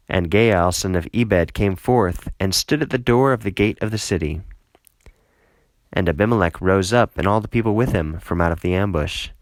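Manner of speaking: ordinary talk, nothing marked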